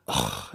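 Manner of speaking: very low, soft groan; imitated disgusted sigh. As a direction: groan like an exasperated stranger. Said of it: "she saw me and went".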